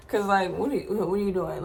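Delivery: ordinary talk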